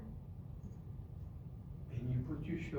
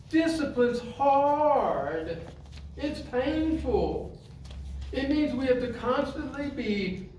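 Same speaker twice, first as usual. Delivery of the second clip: slow, weighty stretched key words at a raised pitch; forceful preaching; 9 semitones higher, 7 dB louder than usual. Real, not acted. Teaching the audience that self-discipline is hard, forceful preaching. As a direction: preach it hard, leaning on each phrase.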